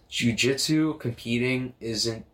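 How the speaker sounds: ordinary talk, nothing marked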